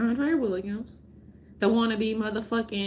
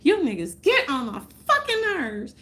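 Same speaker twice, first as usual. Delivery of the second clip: a raised, exasperated snap; retold angry outburst; 6 semitones higher than usual. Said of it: "I say".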